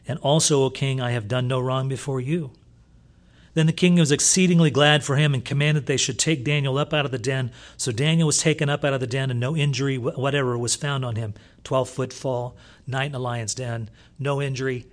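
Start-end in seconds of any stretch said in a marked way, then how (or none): 0.1-11.3 preaching at full force. Acted. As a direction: proclaim the verses with full power. Preacher reads scripture at full force.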